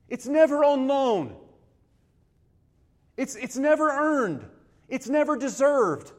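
never hit high and loud each time; stress on never. Acted. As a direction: punch every never. Preacher hammering a point.

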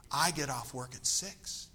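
soft, slow, breathy purr. Retold or imitated. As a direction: imitate a sultry radio DJ, breathy. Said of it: "And she says in a breathy voice".

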